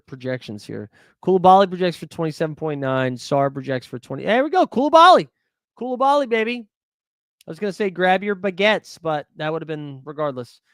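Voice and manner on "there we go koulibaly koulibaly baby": loud, high burst of joy, fading off; excited cheer. Real, not acted. Streamer celebrating a good projection, excited cheer. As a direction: cheer the name like a win. Projection favors his pick.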